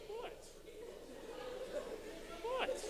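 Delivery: very high-pitched squeaky what. Acted. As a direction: squeak it out in mock confusion. Mock confused echo.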